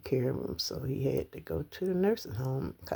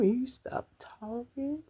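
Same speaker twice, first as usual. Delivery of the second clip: ordinary delivery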